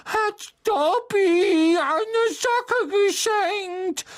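squeaky, very high and slow; drawn-out loud elf wonder; high-pitched creature in astonishment; amazed house-elf. A narrator's reading. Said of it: "sagte der Elf verwundert".

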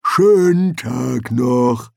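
very slow, loud troll farewell; lumbering, unbothered troll. Acted. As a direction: draw each word out like a ponderous troll. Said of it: "sagte der Troll".

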